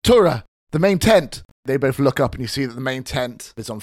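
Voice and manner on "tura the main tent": raised, alarmed call; urgent shout. Acted. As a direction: yell the warning across camp. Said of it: "You hear Une go".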